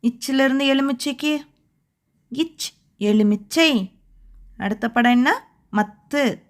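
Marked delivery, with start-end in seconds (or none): 2.3-3.9 இச், எலுமிச்சை said slowly with a pause between; teacher linking a sound to a word for children; slow phonics model. Shown as a demonstration. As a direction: separate இச் then glide into எலுமிச்சை. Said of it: "என்ன எழுத்து வருது?".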